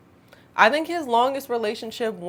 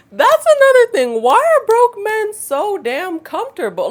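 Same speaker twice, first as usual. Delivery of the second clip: much higher and louder, fed-up rant; exasperated outburst; 13 semitones higher, 10 dB louder than usual. Real, not acted. Telling viewers about broke men, an exasperated loud outburst.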